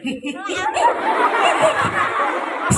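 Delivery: high laugh. Acted. Storyteller laughs as a character.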